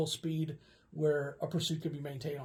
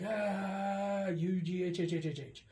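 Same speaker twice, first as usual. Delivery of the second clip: frustrated groan; 3 semitones higher, slower than usual. Retold or imitated. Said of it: "and I'm like".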